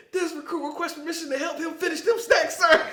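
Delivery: can barely hold it together asking; laughing recruit voice. Retold or imitated. McMillan asks to help eat the snacks, laughing. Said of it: "He said".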